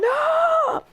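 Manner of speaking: high, loud anguished scream; imitating a howl of grief; hearing the news, screaming no. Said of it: "Daz going".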